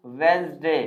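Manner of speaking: "वेडनेसडे" as wenz-day, silent D; two syllables in "वेडनेसडे", wenz-day; correct pronunciation. Shown as a demonstration. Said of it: "आप इसे लिखिए". ("वेडनेसडे" को 'वेंज़डे' बोलें)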